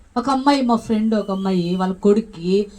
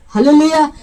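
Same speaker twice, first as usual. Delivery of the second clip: loud hallelujah call; 3 semitones higher, 8 dB louder than usual. Real, not acted. Preacher rousing the congregation with a loud call. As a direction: ring out the hallelujah to the church.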